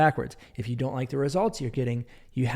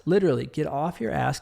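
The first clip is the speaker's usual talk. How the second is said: usual speaking voice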